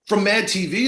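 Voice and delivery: high rising excited question; excited disbelief. Retold or imitated. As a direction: mimic the sister's thrilled check. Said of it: "She's like".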